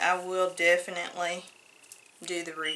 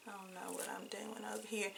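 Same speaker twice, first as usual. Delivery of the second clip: very quiet aside; 17 dB quieter, faster than usual. Real, not acted. Self-deprecating aside.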